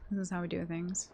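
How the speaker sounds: muttered resignation; resigned and quiet. Real, not acted. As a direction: let it drop flat in defeat. Resigned repeat.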